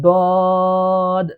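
দদ with the vowel drawn out; long dad. Shown as a demonstration. Teacher showing the class a long দদ. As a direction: hold দদ long. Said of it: "তিন আলিফ পরিমাণ টান দিয়ে পড়তে হবে".

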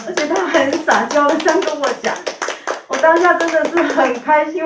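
bubbling over with real delight; happy laughing. Real, not acted. Mother recalling her daughter's sweet words, laughing with real delight.

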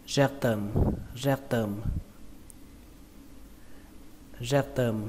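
"rectum" with an unaspirated T, like "réc-tầm"; plain T. Shown as a demonstration. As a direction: say "rectum" stressed first, soft plain T in "tum". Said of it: "có thể chuyển thành âm T luôn cũng được". (đọc "rectum" với âm T không bật hơi, nhấn âm đầu)

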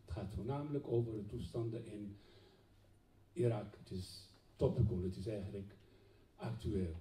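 usual speaking voice

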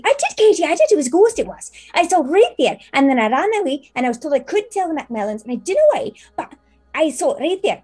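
put-on very high, loud girlish chatter; a giddy teenage maid insisting; excited teen maid. GM voicing maid Teresa to her friend, high and giddy.

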